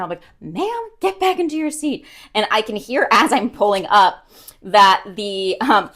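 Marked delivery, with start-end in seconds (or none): 0.4-2.1 high scolding call to the dog; shrill-ish ma'am, half-joking yell; mock scolding yell. Owner retelling yelling at her dog in the car, mock scolding. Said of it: "I start yelling at her".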